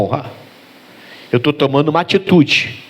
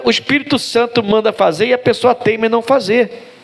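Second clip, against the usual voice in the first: ordinary talk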